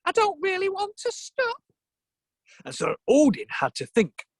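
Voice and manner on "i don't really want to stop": high-pitched squirrel voice; Ratatosk. Acted. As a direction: refuse in a high little voice. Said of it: "Which means".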